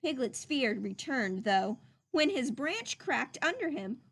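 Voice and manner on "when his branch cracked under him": usual speaking voice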